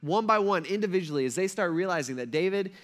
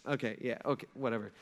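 dismissive mutter; 7 semitones lower, 9 dB quieter, faster than usual. Retold or imitated. Goliath shrugging off David.

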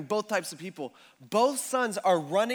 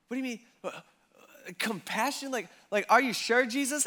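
higher, puzzled questioning; incredulous crowd; 5 semitones higher than usual. Retold or imitated. The crowd questions Jesus, mildly incredulous. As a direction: ask it like you can't believe it. Crowd doubting the father's compassion.

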